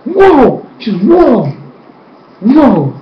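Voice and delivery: a crowd reeling at the news; stunned crowd. Retold or imitated. Crowd hearing about Tarlock's family, amazed whoas. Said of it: "Everybody's like".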